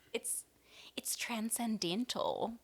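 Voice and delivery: whispering. Real, not acted. Gushing about the album.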